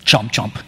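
low mock chewing sounds, chomp chomp; playful munching sound effect; chomping noises. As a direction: mimic a snake munching fruit. Serpent eating the fruit.

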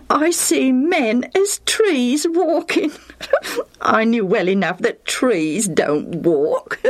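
slow, high quote breaking into laughter; amused chuckling. Acted. Lucius recounting trees walking, laughing. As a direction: relish the silly line, then chuckle.

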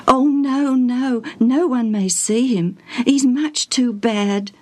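plain reading voice

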